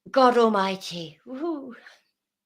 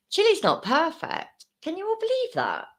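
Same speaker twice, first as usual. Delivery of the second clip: high, rising mock disbelief; high mock-outraged "Chile's" rising; mock shock; 10 semitones higher than usual. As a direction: announce it like scandalous news.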